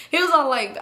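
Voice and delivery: ordinary delivery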